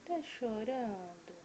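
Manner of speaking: hushed caring questions; tender comforting. Real, not acted. Comforting crying child.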